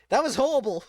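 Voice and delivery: high, loud laughing groan of disgust. Real, not acted. Player laughing at a gross game ending, high and loud. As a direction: laugh it off in horror.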